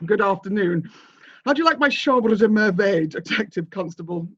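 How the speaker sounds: grand host showing off his collection; theatrical manor master. Acted. Master of the manor receiving a detective constable, theatrical. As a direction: play the master greeting his visitor with a flourish.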